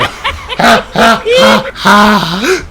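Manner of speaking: high rising giggle; giggling laugh. Real, not acted. Vlogger giggles at his own joke. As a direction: giggle helplessly.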